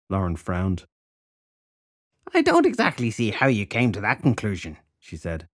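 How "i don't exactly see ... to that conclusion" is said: scolding crone. Acted. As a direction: lecture her like a crone. Crone voice again.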